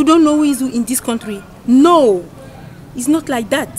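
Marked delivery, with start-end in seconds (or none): none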